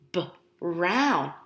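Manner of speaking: plain reading voice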